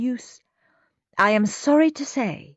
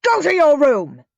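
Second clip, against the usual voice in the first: loud, higher angry command; yelling; 9 semitones higher, 9 dB louder than usual. A narrator's reading. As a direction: bark the order at the boy. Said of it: "yelled Dad".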